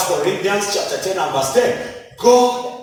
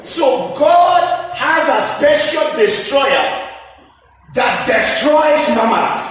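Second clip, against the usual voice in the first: preaching shout; 4 semitones higher than usual. Acted. Preacher warning the congregation, shouting. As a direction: call it out to the church.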